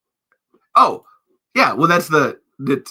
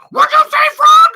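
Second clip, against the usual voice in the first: very high, loud diva shriek; furious Piggy shout; 16 dB louder than usual. Pretend. Piggy snapping at the frog, shrieked. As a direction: screech the challenge.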